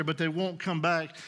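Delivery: normal talking voice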